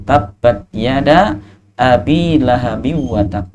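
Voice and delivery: slow recited Arabic; slow Quranic recitation. Acted.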